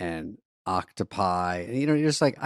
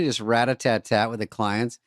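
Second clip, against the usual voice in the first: ordinary talk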